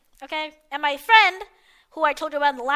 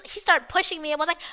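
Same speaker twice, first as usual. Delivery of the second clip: ordinary talk, nothing marked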